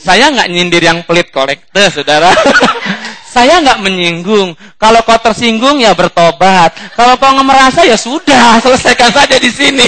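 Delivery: loud, high teasing with laughter; laughing tease. Real, not acted. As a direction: poke fun at stingy givers while laughing. Teasing stingy givers, laughing.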